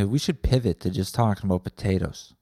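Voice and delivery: ordinary talk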